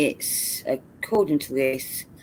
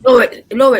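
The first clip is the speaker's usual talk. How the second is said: calling out; 9 semitones higher, 9 dB louder, much faster than usual. Real, not acted. Calling out to driver.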